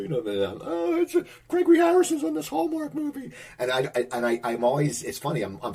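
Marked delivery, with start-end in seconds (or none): 0.6-3.3 very high, held-up falsetto exclamation; mock excited yell. Viewers spotting a friend in a Hallmark movie, mock excited yell. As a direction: call it out high, imitating a thrilled TV viewer. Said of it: "Joanne will yell".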